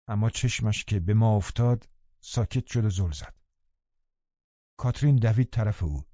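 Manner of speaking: usual speaking voice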